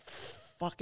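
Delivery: whispered curse to herself; inner whisper. Retold or imitated. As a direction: breathe the curse like a thought. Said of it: "In my mind".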